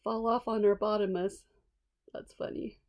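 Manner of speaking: ordinary delivery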